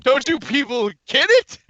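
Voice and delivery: hamming a goofy rant for friends; mock exasperated character. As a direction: squeal it in a goofy voice.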